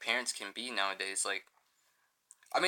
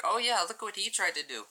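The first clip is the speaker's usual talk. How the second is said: much higher put-on voice of a mother showing off her son's failure; mocking mom; 10 semitones higher than usual. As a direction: mimic mom bragging to her friends. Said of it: "she'd be like".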